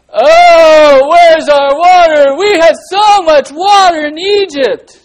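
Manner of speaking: loud, high, drawn-out complaining wail; mock grumbling. Teacher acts out thirsty Israelites for children, loud hammed-up grumbling. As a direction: ham up a slow, shouted whine of grievance. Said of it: "they started to grumble against God".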